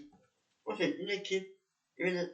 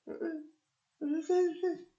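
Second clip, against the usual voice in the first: much higher put-on woman's voice, hesitant; drunk woman; 12 semitones higher than usual. Drunk woman conceding to the officer, high put-on voice. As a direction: give in like the tipsy lady, high-pitched.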